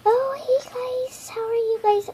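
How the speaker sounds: high, soft sweet doll voice; Periwinkle doll voice. Acted. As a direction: greet like a gentle doll. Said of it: "this is Periwinkle".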